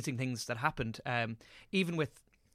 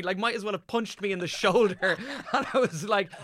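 ordinary delivery

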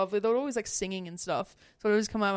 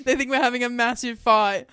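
usual speaking voice

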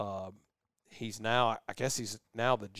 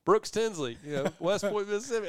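introducing himself; 8 semitones higher than usual. Retold or imitated.

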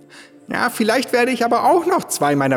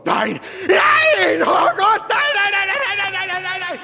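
a man losing it on hearing he's locked in; high panicked cries, trailing off; panicked screaming. Acted. Panics on learning they're locked in.

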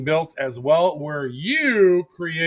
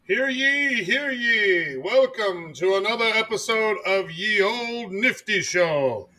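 town crier; 4 semitones higher than usual. Pretend. Mock-medieval show opening.

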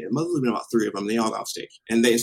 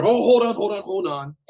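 urgent interjection; 8 semitones higher, 5 dB louder than usual. Retold or imitated. Acts out someone stepping in.

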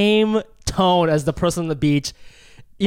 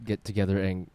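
lower, softer, deadpan seduction; skit voice; 4 semitones lower, 5 dB quieter than usual. Acted. Host inviting Kelly to his room in a low deadpan skit. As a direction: play it awkward and smarmy. Staged skit before sponsor read.

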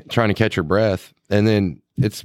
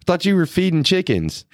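incredulous high quote; 11 semitones higher, slower than usual. Retold or imitated. Retelling surprise at his wife.

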